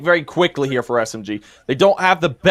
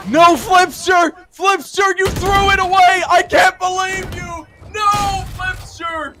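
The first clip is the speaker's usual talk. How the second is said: frustrated shout; 10 semitones higher, slower than usual. Real, not acted. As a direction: scream your disbelief at his blunder. Caster yells at player's throw.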